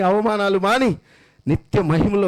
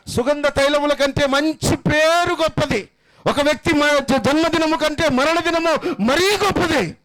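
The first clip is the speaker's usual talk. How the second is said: preaching at full force; 7 semitones higher than usual. Acted. Pastor to mourners at a funeral, soaring fervent preaching. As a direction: lift the congregation with soaring praise. Funeral sermon, rising fervour.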